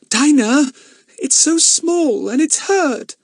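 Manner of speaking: high, slow shaky pleading. Acted. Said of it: "in a shaky voice".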